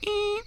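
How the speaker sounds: very high squeaky bagpipe noise; imitated bagpipe wheeze when hugged; bagpipe squeak.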